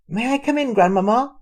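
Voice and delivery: put-on high, loud girl calling at the door; polite child calling out; little girl calling. A narrator's reading. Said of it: "she called".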